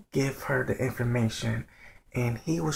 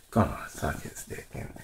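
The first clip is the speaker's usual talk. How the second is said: sighing aside; 10 semitones lower, 6 dB quieter than usual. Real, not acted.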